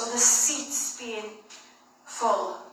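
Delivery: usual speaking voice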